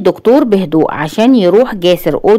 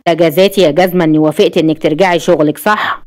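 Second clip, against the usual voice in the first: plain reading voice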